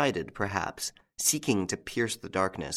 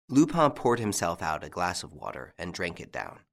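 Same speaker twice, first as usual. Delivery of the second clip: usual speaking voice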